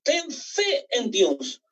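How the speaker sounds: slow personal charge. Acted. Preacher tells each church member by name, slowly. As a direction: hand each word to them slowly.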